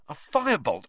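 high excited exclaim. A narrator's reading. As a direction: exclaim it eagerly. Wood exclaims, then muses.

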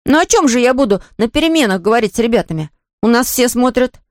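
put-on high-pitched child's protest; boy justifying himself. Мальчик оправдывается перед папой. (мальчик оправдывается высоким голосом)

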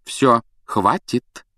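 firm, slow telling-off; reining them in. A narrator's reading. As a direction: space the words out as a mother's warning. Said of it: "одёрнула близнецов миссис Уизли".

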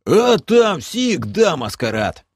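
grouse like a gruff half-orc; grumbling half-orc. Acted. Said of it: "проворчал Бом".

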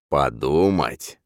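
slow, sneering smirk. Acted. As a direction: smirk the word back at him. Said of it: "ухмыляется собеседник". (с ухмылкой)

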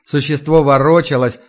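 plain reading voice

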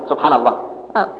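ordinary talk

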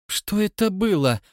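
a scared man pleading for an answer; high, fearful, wavering question; frightened, plaintive. A narrator's reading. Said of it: "нетвёрдым от страха голосом жалобно спросил".

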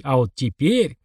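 ordinary delivery

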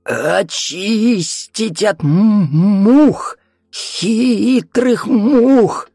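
very slow, high and loud rasping cry; possessed rasping stutter; hoarse rough voice. A narrator's reading. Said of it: "хриплый, грубый".

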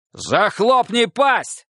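high, slow barked order; harsh barking commands. A narrator's reading. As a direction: bark the order at him. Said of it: "рявкнул на него Лёха".

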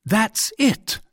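high royal exclamation; sudden high-pitched outburst; excited cry. A narrator's reading. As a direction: cry out as if suspicions are confirmed. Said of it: "cried the king".

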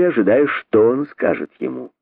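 plain reading voice

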